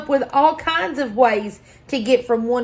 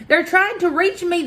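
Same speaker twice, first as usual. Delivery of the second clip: higher-pitched wonder; marveling; 7 semitones higher than usual. A narrator's reading. The moon seeing pyramids rise toward her, marveling. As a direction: marvel at it with a lifted voice. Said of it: "the moon marveled".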